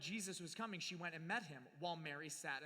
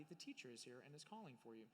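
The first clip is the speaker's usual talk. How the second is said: softly told in a low hush; quiet; 7 semitones lower, 13 dB quieter than usual. A narrator's reading. Martha quietly telling her sister Mary. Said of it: "saying quietly".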